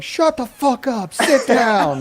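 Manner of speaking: high, drawn-out mock heckle; shouting down. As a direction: imitate a roomful yelling at the newcomer. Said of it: "the people that were shouting the loudest".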